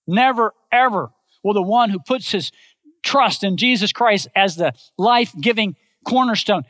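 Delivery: ordinary talk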